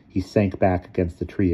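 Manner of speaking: plain reading voice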